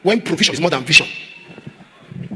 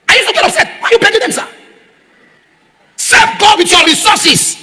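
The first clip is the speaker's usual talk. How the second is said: indignant shout; 12 semitones higher, 5 dB louder than usual. Retold or imitated. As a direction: shout the rebuke up high, slowing at the end. Retold rebuke to pastor, climaxing.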